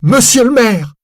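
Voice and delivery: shrieked high and loud, full terror; desperate scream. Acted. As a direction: scream it as if the sky is falling. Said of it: "Elle crie".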